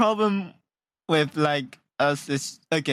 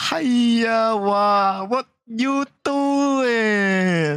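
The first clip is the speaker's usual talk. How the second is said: put-on high, loud, dragged-out Chinese accent; mock Chinese accent; 6 semitones higher, 4 dB louder, much slower than usual. Friends showing off, high slow mock Chinese accent. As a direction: stretch every word in a hammy Chinese accent. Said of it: "show the Chinese accent".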